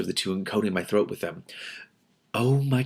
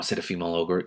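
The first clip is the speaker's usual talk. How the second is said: ordinary delivery, nothing marked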